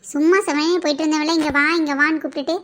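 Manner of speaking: loud, scolding threat; mock threat. Cousin scolding him for forgetting his promises, loud. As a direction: shout him down playfully.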